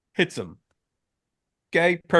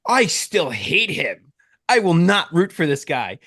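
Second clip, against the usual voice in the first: put-on stubborn kid; 5 semitones higher, 3 dB louder, slower than usual. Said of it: "he's like".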